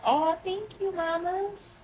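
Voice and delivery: put-on high, sugary thank-you to a little kid; sweet mommy voice. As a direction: coo it like a mom to her toddler. Thanking her four-year-old daughter.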